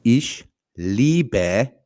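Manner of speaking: German split word by word with pauses; slow language demonstration. Shown as a demonstration. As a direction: model each word for a learner, pausing between. Repeating the German for learner.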